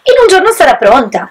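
lightly voiced, content with his straw house; satisfied pig. A narrator's reading. Little pig boasting to his brothers, mildly pleased. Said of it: "disse soddisfatto ai fratelli".

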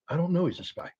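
soft, subdued reply; quiet denial. Retold or imitated. Marina quietly denying to shouting agent, soft denial. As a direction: answer quietly, holding firm.

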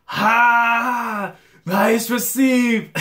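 high, loud, drawn-out shout; excited cheer. Acted. Player cheering a teammate's receive, excited shout.

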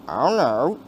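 ordinary delivery

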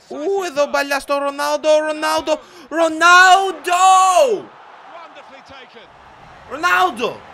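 high, loud commentator yell, building; excited shouting. Real, not acted. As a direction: stretch the player's name into a long scream. Commentating an attack by Ronaldo.

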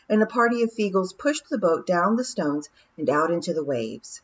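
plain reading voice

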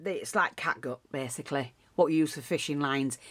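ordinary delivery

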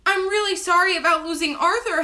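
put-on high, small child's apology; sniffly tiny voice. A narrator's reading. Henry apologising to Angelina, sniffly tiny voice. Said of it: "Henry sniffled in a tiny voice".

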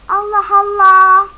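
slow, loud Allah Allah; exasperated shout. Real, not acted. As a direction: drag it out in loud disbelief. Oyunda sinirlenip bağırıyor. (yüksek sesle, sinirli uzatma)

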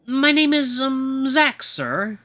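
timid little servant searching for words; nervous. Acted. Butler gives his name to his new master, nervously. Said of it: "he seems really nervous to be around me".

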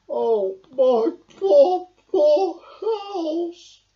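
loud, high lament; grieving oh my poor house; sad Badger. A narrator's reading. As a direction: mourn the lost home. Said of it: "Badger sighed sadly".

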